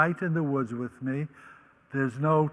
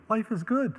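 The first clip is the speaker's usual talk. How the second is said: normal talking voice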